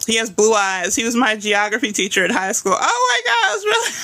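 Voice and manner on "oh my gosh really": thrilled disbelief, much higher pitch; squealing with surprise at a viewer's comment; excited surprise. Real, not acted. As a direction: gasp out a high, delighted oh my gosh. Reacting to chat message about teacher.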